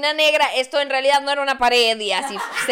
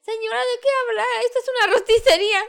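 mock shop clerk; 9 semitones higher, faster than usual.

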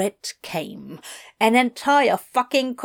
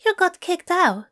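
high, dawning-concern Aziraphale; Aziraphale realizing; 9 semitones higher than usual. A narrator's reading.

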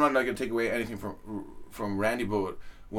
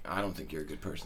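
deadpan put-down; 7 semitones lower, 10 dB quieter, faster than usual. Pretend. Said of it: "I'd be like".

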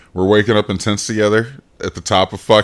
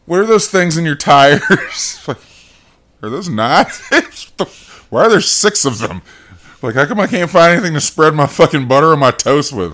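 higher, puzzled questioning voice; lightly voiced bewildered visitor; mock confused guest; 7 semitones higher, 4 dB louder than usual. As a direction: act baffled about the hidden knives.

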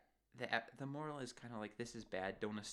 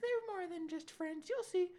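very high, drawn-out teasing voice; mock singsong insistence; 6 dB louder than usual. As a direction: tease it out slowly, pitched way up. Acting out Connie insisting.

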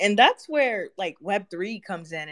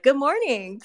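bright, higher chirpy good morning; cheery greeting; 8 semitones higher, 3 dB louder than usual. Retold or imitated. TSA guard greets grumpy passengers at dawn, higher and chirpy. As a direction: sing it out to the passengers like a happy guard. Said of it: "and I would just be so happy".

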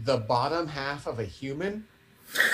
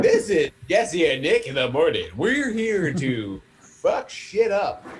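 shock jock; 3 semitones higher, 4 dB louder, slower than usual. Retold or imitated. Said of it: "And we're like".

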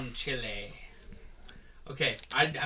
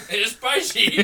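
laughing; 6 semitones higher, 8 dB louder than usual. Real, not acted. Spicy candy, laughing.

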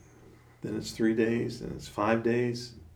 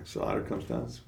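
usual speaking voice

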